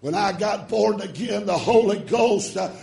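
shouted, high preaching with long stretched words; full-force preaching. Acted. Preacher shouting salvation to his congregation, full-force preaching. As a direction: preach it hard to the church.

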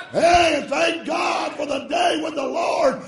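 shouted, high preaching with long stretched words; full-force preaching. Acted. Preacher shouting salvation to his congregation, full-force preaching. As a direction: preach it hard to the church.

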